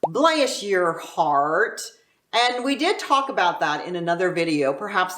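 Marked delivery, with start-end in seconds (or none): 0.0-1.8 "bless your heart" drawn out very slowly; syrupy slow Texan blessing; slow Southern bless your heart. Shown as a demonstration. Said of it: "number three Texas urban slang is".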